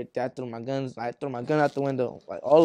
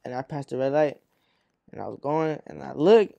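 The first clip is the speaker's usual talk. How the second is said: ordinary talk, nothing marked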